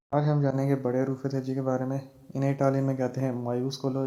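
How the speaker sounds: ordinary talk, nothing marked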